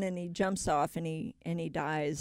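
ordinary talk

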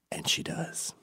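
hushed, breathless reveal; whispered punchline. Real, not acted. Telling the crowd the punchline, whispered. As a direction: drop it to a whisper for the payoff.

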